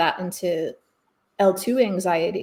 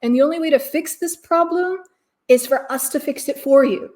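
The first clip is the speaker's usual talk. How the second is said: mock corporate voice; 8 semitones higher, 5 dB louder than usual. Mocking the industry selling you a fix, in a higher, louder, slowed voice. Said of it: "by saying".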